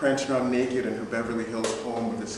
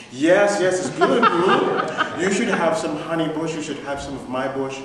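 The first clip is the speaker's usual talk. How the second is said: giggling, gushing boss; 4 semitones higher, 6 dB louder than usual. Retold or imitated.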